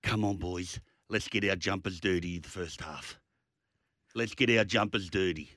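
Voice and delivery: put-on low, calm pep talk to kids; rally young players gently; coach pep talk. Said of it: "what you tell the, what your young kid".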